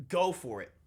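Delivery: ordinary talk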